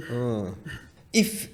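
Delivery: ordinary talk, nothing marked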